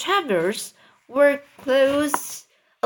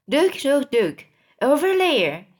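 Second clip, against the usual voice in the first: higher-pitched excited calling; mild shout; 4 semitones higher than usual. A narrator's reading. Ladybug points out a sight to everybody, mild shout. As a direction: call the others over, lightly raised voice. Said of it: "shouted the ladybug".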